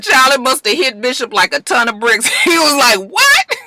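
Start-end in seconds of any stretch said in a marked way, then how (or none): 3.1-3.6 high, stunned yelp; shocked what. Retold or imitated. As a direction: squeak it out in shock. Bishop stunned by the news.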